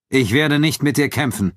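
usual speaking voice

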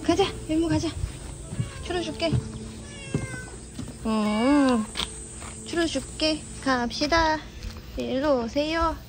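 high soft coaxing pet voice. Real, not acted. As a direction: coax the cats sweetly. Coaxing cats with treats. (간식으로 고양이를 부르는 높고 부드러운 말투)

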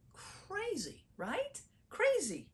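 squeaky, incredulous aside to the congregation; high-pitched disbelief. Real, not acted. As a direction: pitch way up in amazement. Preacher reacts to the moral.

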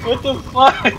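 high-pitched exasperated rant; exasperated outburst. Real, not acted. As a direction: vent it high, all worked up. Venting about tedious gameplay.

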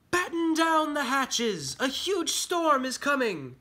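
high, loud, drawn-out alarm call; crying out. Acted. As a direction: call the warning across the whole deck, slowly. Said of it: "Henry cried".

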